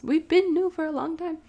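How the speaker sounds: ordinary talk, nothing marked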